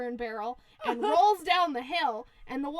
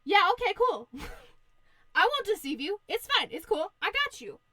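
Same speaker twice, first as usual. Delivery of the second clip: higher, eager pig promise; over-eager reassurance; 6 semitones higher, 4 dB louder than usual. Acted. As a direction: brush off doubts with lively, raised pitch. Pig falsely reassures the wolf.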